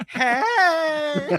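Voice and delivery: high, drawn-out campy greeting; Nathan Lane at drag brunch. Acted. Host imagining the villain's reveal as a drag brunch diva, high and campy. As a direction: sing out a flamboyant, stretched hello. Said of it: "sound like Nathan Lane at a cross, at, at a drag brunch".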